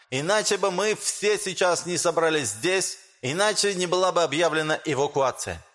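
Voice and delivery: high, lisping speech with sh for s. Acted. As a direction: lisp through a rousing public speech. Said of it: "По шепелявому голосу".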